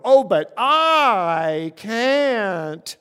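loud, long-drawn mock whine; whiny excuse. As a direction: drag it out like a reluctant complainer. Mimics people's excuse to God.

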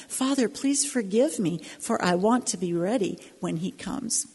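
spoken song lyrics, steady and earnest; poem recitation. Acted.

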